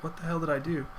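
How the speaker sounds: soft, bewildered question; puzzled disbelief. Retold or imitated. Imitating a friend trying to figure it out, softly puzzled. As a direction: wonder it under your breath. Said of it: "he's trying to figure out".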